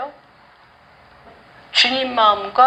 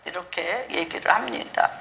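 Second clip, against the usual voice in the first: hushed whisper, much softer; whispered aside; 6 semitones lower, 8 dB quieter than usual. Real, not acted. Preacher whispering an aside to the congregation after the prayer.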